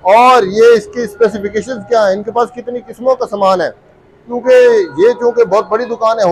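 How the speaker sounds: upbeat presenter voice to camera; vlog host intro. Acted. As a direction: welcome the viewers like a YouTube host. Host's video intro to camera.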